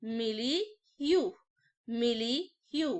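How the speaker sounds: Milieu stretched out very slowly, syllable by syllable; teacher drawing out milieu very slowly; slow careful pronunciation. Shown as a demonstration. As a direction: spell out milieu slowly for the class. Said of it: "अगला word लिखा है milieu".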